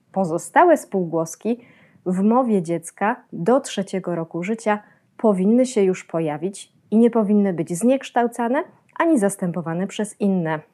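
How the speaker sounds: ordinary talk, nothing marked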